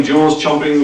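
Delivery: ordinary delivery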